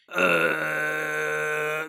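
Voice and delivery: long low grinding groan; stone door sound. Retold or imitated. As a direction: imitate a heavy door scraping open. Imitates the stone door sliding open.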